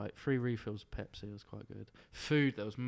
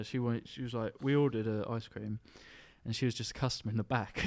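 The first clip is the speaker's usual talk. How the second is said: ordinary delivery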